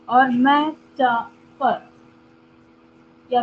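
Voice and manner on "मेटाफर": मेटाफर slowly broken into three syllables. Shown as a demonstration. Teacher giving students an example, मेटाफर split by syllable. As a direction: pause twice inside मेटाफर. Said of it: "दो बार break करना हो रहा है".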